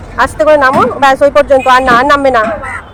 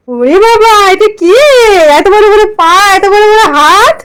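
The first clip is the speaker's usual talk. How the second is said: mock fright; 6 semitones higher than usual. Uncle pretends to fear monster.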